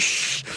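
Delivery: imitated cautionary "shh"; hushing shush. Retold hushing near agent's window.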